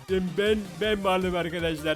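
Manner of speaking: daha pes, yavaş canavar sesi; korkunç yaratık gibi ağır ağır konuş. Pretend. Korkunç kalbi yiyip canavara dönüştü. (canavara dönüşüp izleyicilere tanıtıyor, pes ve yavaş canavar sesi)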